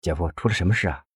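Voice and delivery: soft, quick question; hushed worried query to a brother-in-law; asking quietly. A narrator's reading. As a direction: ask it under your voice, discreetly. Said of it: "小声地问".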